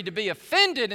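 normal talking voice

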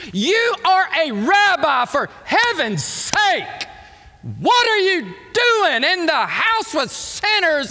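high, loud, drawn-out mock scolding; acted indignant reproach; scandalized Pharisee. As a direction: berate a rabbi in hammed-up outrage.

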